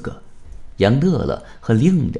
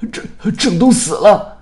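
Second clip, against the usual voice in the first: high, loud panicked announcement; terrified stammer; 11 semitones higher, 8 dB louder than usual. A narrator's reading. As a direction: stumble on the first word, then cry out in fright. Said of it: "还带着一丝惊恐". (惊恐地结巴喊叫)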